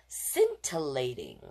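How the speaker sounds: Scintillating said slowly, each syllable distinct; savoring the word. Shown as a demonstration. Teacher relishing a sparkly word for the kids, slow. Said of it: "that's a fun one".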